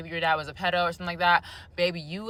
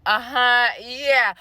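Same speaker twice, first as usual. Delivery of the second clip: high, loud knowing agreement; sassy uh-huh; 6 semitones higher, 5 dB louder, much slower than usual. Retold or imitated. Reacting to girls bragging about dads, sassy. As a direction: own it like you relate completely. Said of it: "and I'm like".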